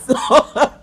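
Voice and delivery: laughing hard through the words. Real, not acted. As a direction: crack up while talking. Laughing at own fantasy.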